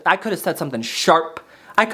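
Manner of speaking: ordinary talk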